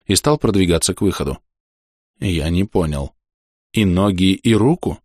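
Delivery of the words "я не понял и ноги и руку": strange voice. Acted. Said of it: "спросил я не своим голосом".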